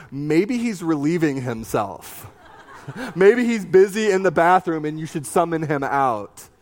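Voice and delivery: laughing Elijah taunt. Retold or imitated. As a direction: crack up while mocking. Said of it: "where Elijah says".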